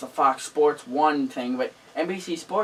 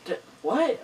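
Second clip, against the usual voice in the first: surprised; 9 semitones higher than usual. Retold or imitated. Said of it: "he's like".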